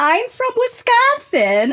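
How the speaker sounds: put-on high, slow, stretched Midwestern accent. Storyteller revealing a Wisconsin origin, high drawn-out Midwestern accent. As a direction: draw it out in a hammed-up Midwestern accent.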